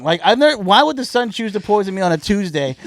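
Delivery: hammed-up fury at a bad diagnosis; incredulous rant. Ranting at the doctor.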